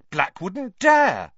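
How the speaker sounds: slow, loud and defiant; spirited. A narrator's reading. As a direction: lean in, full of daring. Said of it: "said Ron spiritedly".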